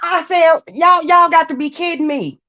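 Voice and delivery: heated, flustered rant; agitated and high. Real, not acted. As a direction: pitch up, words tumbling out. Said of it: "I can't even get my words out".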